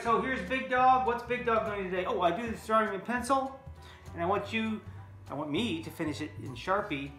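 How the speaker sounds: usual speaking voice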